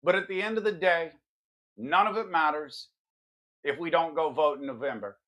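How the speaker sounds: ordinary talk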